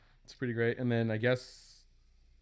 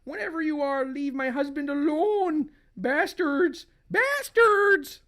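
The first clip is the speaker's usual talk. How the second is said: high, angry cry building to a shout; furious wife; 4 dB louder than usual. Acted. Wife defends possessed husband, high building fury. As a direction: rage at them, last word loudest and highest.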